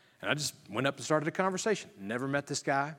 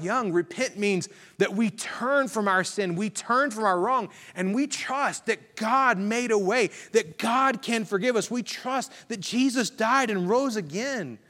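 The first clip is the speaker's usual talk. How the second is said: raised pitch, driving parallel phrases; fervent preaching; 7 semitones higher, slower than usual. Acted. Pastor explaining repentance to the student, preached fervently. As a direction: press each phrase home like a preacher.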